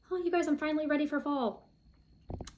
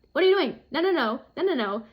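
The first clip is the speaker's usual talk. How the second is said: higher, louder retold alarm; mock alarmed friend; 3 semitones higher, 6 dB louder, faster than usual. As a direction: imitate a shocked friend stopping a mistake. Table mate shocked at wrong use.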